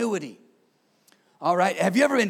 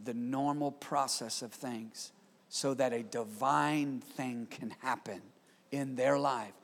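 prayer; 4 semitones lower, 3 dB quieter, slower than usual. Acted. Closing prayer before sermon.

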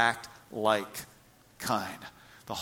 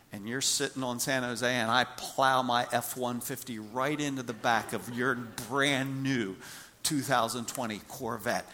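ordinary delivery

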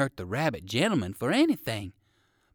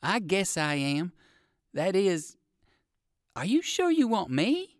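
puzzled question, climbing high at the end; 5 semitones higher than usual. A narrator's reading. Old gentleman rabbit asks the dog if he is wanted, puzzled. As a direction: ask it unsure, voice rising. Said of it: "puzzled like".

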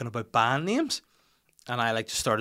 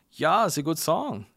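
put-on slower, higher-pitched German accent; 5 semitones higher, 3 dB louder than usual. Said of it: "looked at me and was like".

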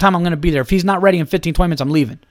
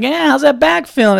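mock doctor; 9 semitones higher, 5 dB louder than usual. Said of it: "he's like".

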